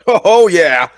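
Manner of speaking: loud, high burst of triumph; excited cheer. Real, not acted. Player seeing a successful melee roll, excited cheer. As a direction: whoop at a great roll.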